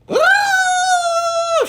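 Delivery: very high, loud squawk; chicken's shriek. A narrator's reading. The chicken shoots into the air with a shriek. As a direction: shriek like a startled bird. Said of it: "shrieked the chicken".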